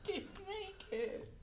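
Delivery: high, slow, giggly denial; laughing whine. Acted. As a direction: plead through laughter.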